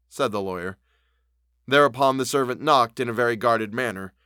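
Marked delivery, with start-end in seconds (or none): none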